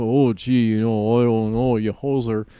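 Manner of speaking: put-on low-pitched caricature Canadian accent. Sending up how Canadians talk, Canadian accent. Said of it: "Canadians have a".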